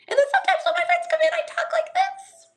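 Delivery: very high, squeaky put-on voice; shrill. Shown as a demonstration. Streamer showing how they talk when friends visit, shrill. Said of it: "I can get really shrill".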